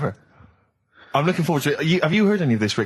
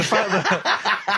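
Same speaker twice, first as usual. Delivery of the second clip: laughing; 6 semitones higher than usual. Real, not acted. Laughing while teasing Carl.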